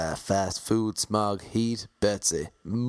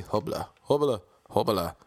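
mumbled gibberish "hubla, hubla, hubla." like a confused driver; mock taxi driver babble. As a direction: imitate a driver's unclear babble. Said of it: "Eventually understands the name".

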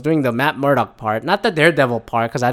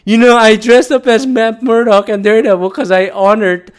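mock self-justifying fan; 7 semitones higher, 7 dB louder than usual. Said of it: "sound like the fucking Washington Foreskins fan".

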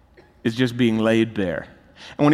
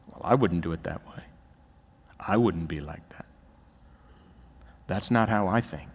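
quiet, smug self-congratulation; soft and pleased with yourself; smug self-righteous; 7 semitones lower, 7 dB quieter than usual. Retold or imitated. Preacher voicing a self-righteous heart, quietly smug.

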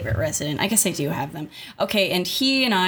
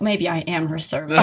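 ordinary talk

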